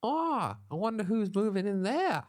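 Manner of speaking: put-on high, bright-eyed boyish squeal; squeaky spunky teenage boy. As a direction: play a cheery spunky lad, way up high. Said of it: "That's how Spunky Boys talk".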